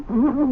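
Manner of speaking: a quick, high gleeful cackle; gleeful laugh. Acted. Husband gloating to his wife about his prank, gleeful laugh. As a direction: laugh with delight at your own prank.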